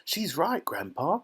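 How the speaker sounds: put-on thrilled little-boy voice; excited boy's cry. A narrator's reading. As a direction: pipe up eagerly as a young boy. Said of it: "cried Charlie".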